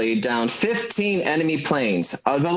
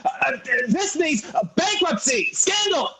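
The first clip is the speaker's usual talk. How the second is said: angry shout; 11 semitones higher than usual. Acted. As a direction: blow up at the old man, rising to a yell. George furious at Uncle Billy.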